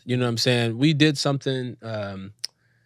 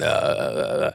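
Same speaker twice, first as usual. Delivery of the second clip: low drawn-out rumbling "uh"; Vin Diesel; 10 semitones lower than usual. Retold or imitated. Actor mimicking his co-star's noise, low rumble. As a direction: imitate a deep-voiced movie star's rumble. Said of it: "got Vin going".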